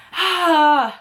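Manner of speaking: loud, high exhaled sigh; big dramatic sigh. Real, not acted. Reviewer about to explain The Cabin, big dramatic sigh. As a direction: let out a big sigh over the book.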